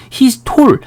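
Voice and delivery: "He's tall" with a full "o" vowel, tol; high-pitched "He's tall" with a round Korean "오"; wrong "o" pronunciation. Shown as a demonstration. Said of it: "이것도 틀린 발음입니다".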